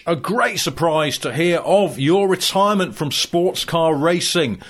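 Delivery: bright, loud TV interviewer voice; hammy sports reporter. Acted. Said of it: "Alan McNish".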